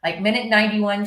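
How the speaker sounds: usual speaking voice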